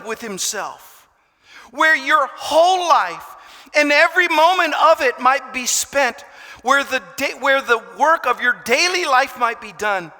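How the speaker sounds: fervent sermon peak; preaching at full force. Acted. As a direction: proclaim it to the hall.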